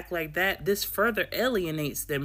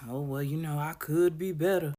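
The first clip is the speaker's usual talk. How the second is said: mopey sigh. Retold or imitated. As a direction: drag it out with self-pity. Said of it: "She like".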